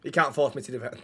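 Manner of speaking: low, soft, petulant refusal; subdued, stubborn pout; sulky refusal. Acted. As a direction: refuse quietly, in a sulk.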